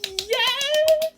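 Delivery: a loud high whoop of yes; cheering on Okoye; excited cheer. Retold or imitated. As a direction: squeal it at the screen. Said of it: "I'm like".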